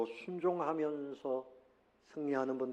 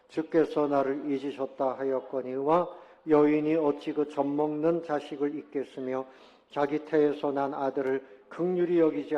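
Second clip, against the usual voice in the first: loud, solemn reading of the verse; scripture reading; 6 dB louder than usual. Acted. Pastor reading the verse aloud to the congregation, loud and solemn. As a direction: proclaim the scripture to the whole church.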